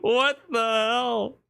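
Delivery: shocked. Real, not acted. Shocked by trailer move.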